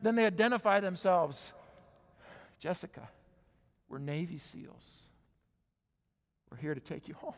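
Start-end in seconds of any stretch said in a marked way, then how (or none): 2.6-7.3 soft, measured rescuer's voice; quietly quoting the rescuers; hushed reassurance. Retold or imitated.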